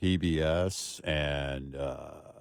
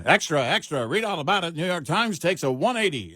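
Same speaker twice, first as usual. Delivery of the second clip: put-on old newsreel; 7 semitones higher than usual. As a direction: call it out like a newsboy on the corner. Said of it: "I heard the old newsreel".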